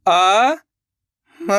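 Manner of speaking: अ and म each held long alone; slow, separated अ ... म; letter by letter. Shown as a demonstration. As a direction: sound out अ, then म, with a gap. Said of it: "एक एक करके".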